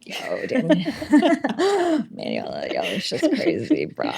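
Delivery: a hint of a drowsy surfer dude; stoner bro voice. Shown as a demonstration. She demos a laid-back bro talking to the host, lazy and soft. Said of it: "the intonation of my voice".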